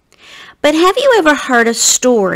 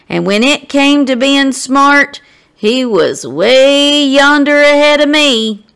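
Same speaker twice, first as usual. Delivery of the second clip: Alston's voice; 5 semitones higher, 4 dB louder than usual. Acted. Alston bragging about his friend's long limbs, in a raised country-boy voice. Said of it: "Alston would say later".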